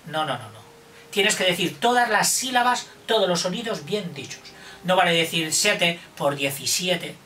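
ordinary talk, nothing marked

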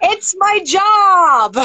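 drawn-out, high-pitched exclamation; mock protest. Real, not acted. Guest joking to the host about doing accents, mock protest. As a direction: exclaim it high and slow, playfully. Mock-defensive joke after laughing.

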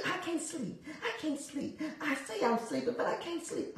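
hushed, high mock lament; mock sleepless complaint. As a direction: voice someone's complaint softly and high. Voicing the restless listener's complaint.

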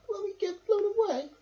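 high, small scared question; high worried bunny voices; frightened bunnies. A narrator's reading. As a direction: ask like frightened baby rabbits. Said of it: "they cried".

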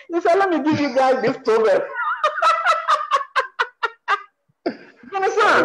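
a quote half-lost in real laughter; laughing. Real, not acted. Teasing the interviewer about his proverb, laughing. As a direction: laugh through the words, teasing him.

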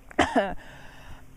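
sudden coughing after swallowing a bug; choking cough on a swallowed bug; coughing fit. Real, not acted. I think I just ate a bug.

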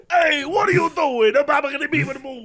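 put-on high, loud New York accent; hammed-up New Yorker picking a fight; New York guy. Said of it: "my New York guy".

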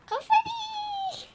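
high, loud drawn-out cry of delight; excited squeal. Real, not acted. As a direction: squeal at the confetti. Delighted by confetti.

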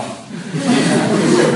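loud, high burst of laughter. Real, not acted. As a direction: laugh out loud mid-story. Laughs while telling the lightning strike. (risata forte e acuta)

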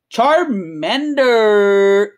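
"Charmander" stretched very slowly; drawn-out name. Real, not acted. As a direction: hold and draw out the end of "Charmander". Card name drawn out while listing.

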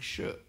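"เชอะ" with a hushing sh; hushing SH sound. Shown as a demonstration. Lecturer showing one of three Sanskrit S sounds, hushing "เชอะ". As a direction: demonstrate "เชอะ" as the Sanskrit palatal sibilant. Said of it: "อคอมันเสียง".

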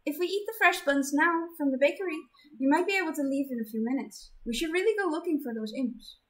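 put-on earnest kind girl; gentle girl voice. Zenith voiced high.

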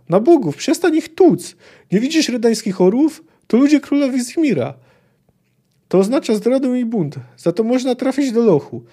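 high, agitated warning; frantic pleading. A narrator's reading. Poet begs Geralt to stop beating soldiers, high alarmed voice.

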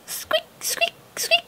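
tiny high squeaks; high mouse squeaks. Acted. Eager mouse helper.

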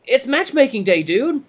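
high, loud, thrilled buddy; excited reveal. A narrator's reading. Redline telling newcomer about the event, excited. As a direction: announce it like the best day ever. Friend excitedly announces matchmaking day.

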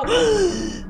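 sharp high-pitched gasp. Acted. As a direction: gasp in mock fright. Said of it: "That make you go".

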